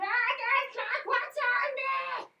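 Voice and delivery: high, loud, slow whine; mock a picky spoiled kid; whiny child complaint. Speaker imitating a fussy child refusing a sandwich, whiny. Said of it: "and you say".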